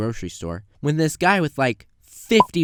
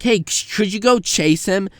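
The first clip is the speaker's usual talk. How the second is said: higher, louder call to the manager; urgent call across the store; 8 semitones higher, 6 dB louder than usual. Retold or imitated. Calling out to manager.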